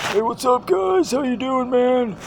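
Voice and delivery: cartoonish high-pitched buddy hello; high mock greeting. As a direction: play the goofy producer saying hi. Voices producer Joe greeting.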